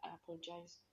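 meek apology. Retold or imitated. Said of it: "And I'm like".

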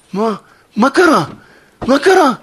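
high, loud alarmed cry; shocked shout. Acted. Daughter hears her father died, shocked shout. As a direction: scream the question in shock. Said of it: "היא צועקת".

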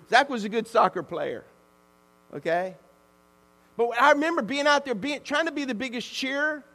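ordinary talk

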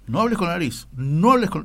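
scolding like a voice coach; correcting teacher. Retold or imitated. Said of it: "te corrigen muchísimo en locución".

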